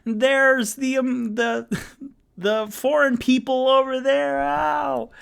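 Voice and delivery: high, loud mock panic, a pained put-on voice; imitating Alan Rickman's fake injury cry, hammed up high. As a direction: ham up a fake leg twist, voice squeaking high. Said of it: "He's like".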